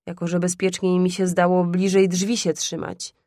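ordinary delivery, nothing marked

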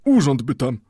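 put-on high casual greeting from a perfumed young clerk; lightly voiced young clerk greets the bookbinder; a light hint of a clerk. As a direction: toss off a breezy hello. The clerk laughs it off.

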